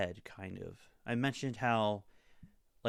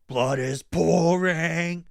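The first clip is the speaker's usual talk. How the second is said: imitated loud, drawn-out, higher metal scream; 5 semitones higher, 10 dB louder, much slower than usual. Reviewer mimicking the other singer's answer in a scream-off, loud slow yell. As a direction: stretch it out in a mock singer's roar. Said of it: "then [name] going".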